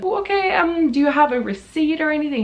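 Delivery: high, bright politeness; sweetly patient shop assistant; super polite. Retold or imitated. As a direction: overpolite retail voice. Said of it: "I'm being super polite the whole time".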